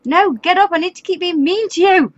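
high-pitched, dismayed scolding; rising alarm at a game gone wrong; exasperated outcry. Real, not acted. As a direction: plead loudly with the character to wake. Scolds sleeping game character.

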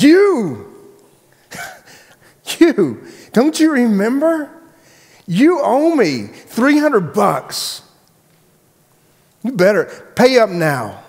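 high, drawn-out accusing outburst; hammed-up, loud high-pitched demand for payment; angry creditor. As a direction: pounce on the debtor, voice shooting way up. Acted creditor accosting his debtor.

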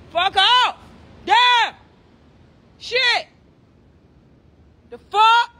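high, loud angry yelling. Real, not acted. Ranting at Instagram alone in a hotel room, yelling. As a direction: blow up at nobody in particular.

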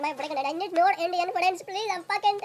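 usual speaking voice

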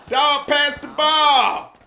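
slow, loud, exasperated; frustrated shout. Real, not acted. Fed up with a teammate, slow loud demand.

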